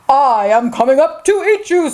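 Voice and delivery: lightly voiced troll threat; menacing troll. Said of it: "said the troll".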